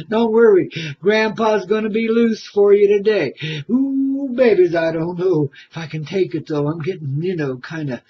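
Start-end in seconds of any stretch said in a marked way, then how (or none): none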